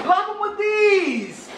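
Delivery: slow, high, laughing through it; laughing joke. Real, not acted. Dad joking about sneezes, laughing as he says it. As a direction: giggle the punchline out.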